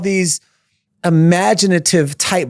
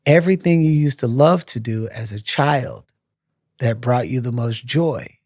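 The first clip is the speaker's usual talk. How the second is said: inner voice; 6 semitones lower, 3 dB quieter than usual. Retold or imitated. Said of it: "a voice inside said".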